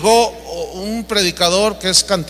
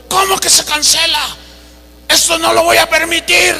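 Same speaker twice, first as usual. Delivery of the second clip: higher, indignant demand; indignant outrage; 7 semitones higher than usual. Retold or imitated. Famous preacher at the airport counter, indignant and higher. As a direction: play the offended celebrity refusing a cancellation.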